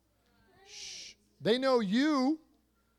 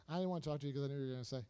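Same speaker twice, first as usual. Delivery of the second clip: very fast, low, softer mumble-through; mimicked excuse; 7 semitones lower, 7 dB quieter, much faster than usual. Young listener avoiding the pastor's advice, hurried quiet excuse. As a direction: rush out the excuse under your voice. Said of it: "you go like this".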